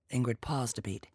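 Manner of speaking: ordinary delivery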